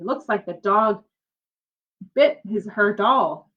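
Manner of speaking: plain reading voice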